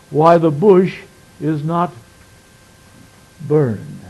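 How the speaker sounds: solemn pulpit reading of Scripture. Acted. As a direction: read the verses out with weight. Reads Exodus passage aloud.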